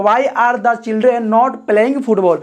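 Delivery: very slow, word by word; dictating slowly to the class; slow dictation. Shown as a demonstration. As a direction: spell it out slowly for students. Dictating the English translation.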